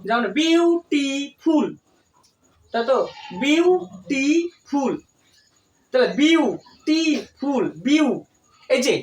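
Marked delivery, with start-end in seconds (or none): 0.4-1.7 beautiful slowly split as বিউ, টি, ফুল; broken into syllables. Shown as a demonstration. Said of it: "থেমে থেমে একটু উচ্চারণ কর". 3.4-4.9 beautiful very slowly, with clear gaps between বিউ, টি and ফুল; teacher sounding out beautiful in three pieces; broken into syllables. Shown as a demonstration. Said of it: "থেমে থেমে একটু উচ্চারণ কর". 6.2-8.1 beautiful slowly split in three, then বিউ alone; halting syllables. Shown as a demonstration. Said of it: "থেমে থেমে একটু উচ্চারণ কর".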